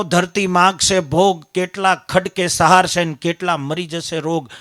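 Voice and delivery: loud, declaiming preacher's voice; preaching at full force. Acted. Preacher quoting a saint's prophecy to the village crowd, loud declaiming. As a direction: thunder the old prophecy at the crowd.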